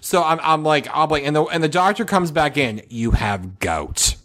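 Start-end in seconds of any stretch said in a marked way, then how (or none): 2.9-4.2 low, very slow pronouncement; doctor's grave verdict. Retold or imitated. Doctor announces the diagnosis.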